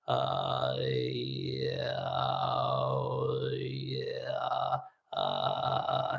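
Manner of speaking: creaky fry sounds dropped low; vocal fry. Shown as a demonstration. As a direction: demo a loose vocal fry. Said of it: "So I'd be like".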